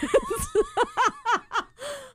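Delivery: high-pitched burst of real laughter. Real, not acted. Podcaster cracking up at her own punchline, high laughter.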